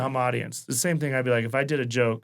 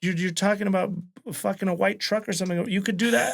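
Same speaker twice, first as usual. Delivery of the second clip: 1 retold angry rant; 7 semitones higher than usual. Scolding talkative audience.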